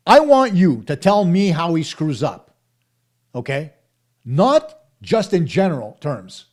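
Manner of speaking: real frustration boiling over at the viewers; worked up. Real, not acted. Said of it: "I'm sorry I got so worked up".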